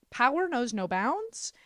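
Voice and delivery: ordinary talk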